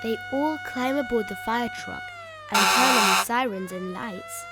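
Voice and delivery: ordinary delivery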